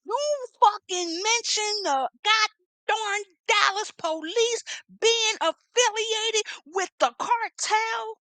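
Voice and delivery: high, slow, incredulous; can't believe what she said; outraged disbelief. Real, not acted.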